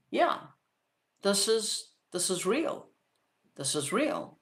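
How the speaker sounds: soft, halting words with pauses between; inner realization. Retold or imitated. Another part of the dreamer taking it in, hushed realization. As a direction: let it sink in softly. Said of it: "another part of me that is looking at this and going".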